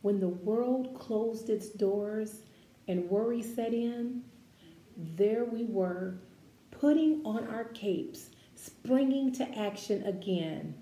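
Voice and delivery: measured, expressive read-aloud voice; gentle picture-book narration; reading to children. Acted. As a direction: read it like a storybook for a class.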